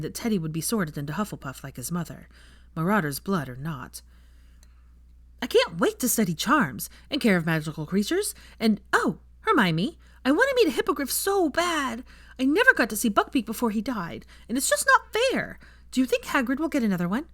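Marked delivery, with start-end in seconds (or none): 5.4-17.3 put-on high, eager six-year-old gushing about the castle; excited little boy. A narrator's reading. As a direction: bubble over like a small boy who can't wait.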